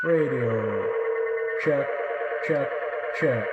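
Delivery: full echo, metallic and ringing; radio check with the echo turned all the way up; toy echo mic. Real, not acted. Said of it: "let's do full".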